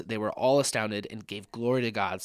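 ordinary talk, nothing marked

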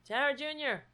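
high call to the cats. Real, not acted. Owner calling her cat, high coaxing.

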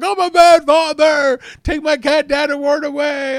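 loud, high hammed-up wail; mock guilty wail. Owner retelling how terrible they felt, mock guilty wailing. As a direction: cry out in exaggerated remorse. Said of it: "I felt terrible".

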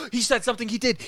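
gasping in disbelief; shocked gasp. Real, not acted. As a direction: gasp then blurt it out. Friend finally says something.

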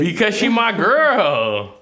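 high, drawn-out indignant comeback; emphatic defensive retort. Real, not acted. Man defending his girlfriend to his co-parent, indignant.

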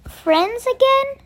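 high, slow, loud hopeful offer; hopeful question. A narrator's reading. Kevin making up with Percy, high hopeful question. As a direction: ask it hopefully, drawn out and high.

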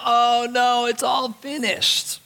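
the wrong reading of Jesus' last words as hopeless; sigh of despair. Acted. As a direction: let it fall out like giving up. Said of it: "Jesus is just sighing out".

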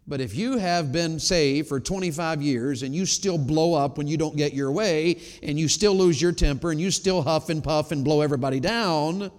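ordinary talk

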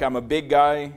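usual speaking voice

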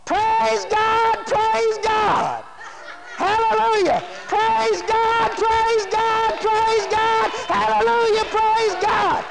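high, ringing repeated shouts; shouted praise. Acted. Healed man praising God, shouting at the top of his voice. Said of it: "he shouted at the top of his voice".

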